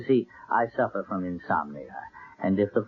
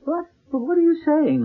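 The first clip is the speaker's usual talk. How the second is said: surprised protest; 4 dB louder than usual. Acted. Accused host protests.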